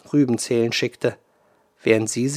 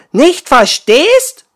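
much higher, louder, slower outrage; outraged; 12 dB louder, much slower than usual. Acted. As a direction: fling it back in fury. Water woman indignant echo.

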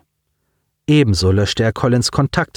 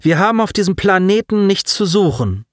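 defiant, chin up; 9 semitones higher than usual. Acted. Hob sein Kinn.

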